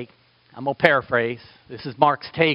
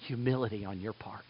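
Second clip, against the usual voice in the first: whisper; 5 semitones lower, 14 dB quieter than usual. Real, not acted. Preacher leaning in to the congregation, whispering. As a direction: drop to a hushed whisper. Sudden hushed aside in sermon.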